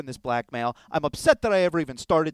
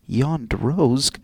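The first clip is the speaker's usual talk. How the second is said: low, measured reading of a signature; formal read-aloud name; letter sign-off; 3 semitones lower, 5 dB louder than usual. Acted. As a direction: sign off solemnly, falling at the end.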